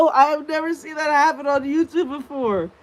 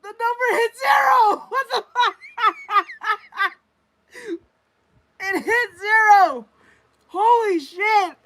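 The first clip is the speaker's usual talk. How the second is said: shouting and laughing in disbelief, pitched way up; stunned excited shouting; 9 semitones higher than usual. Real, not acted. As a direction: yell it out, half laughing, half crying in shock. Viewer count drops to zero.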